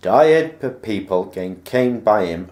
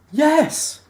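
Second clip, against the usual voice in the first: put-on timid boy reply; 3 dB louder than usual. Boy answers the deputy head.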